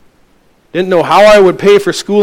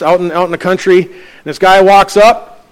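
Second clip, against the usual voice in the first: ordinary talk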